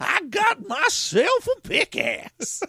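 put-on high slow old-timer; old prospector. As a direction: crow like a gold miner. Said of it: "I'm a prospector".

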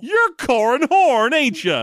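slow, high twangy prospector drawl; old prospector. Retold or imitated. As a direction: play a suspicious old miner. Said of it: "he talks like an old prospector".

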